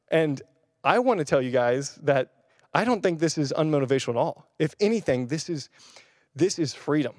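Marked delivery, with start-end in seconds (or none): none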